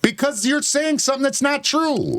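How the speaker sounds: real angry accusation; heated retort. Real, not acted. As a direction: fire back indignantly. Heated quarrel, answering back.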